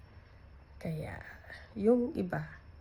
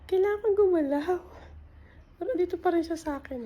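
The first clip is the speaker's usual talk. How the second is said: strained stuck; 10 semitones higher, 5 dB louder than usual. Real, not acted. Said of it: "Hindi ako makagalaw".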